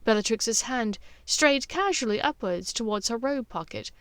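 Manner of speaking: ordinary delivery, nothing marked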